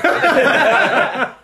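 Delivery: high, slow, laughing mimic; mocking echo. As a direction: parrot him back in a silly high voice.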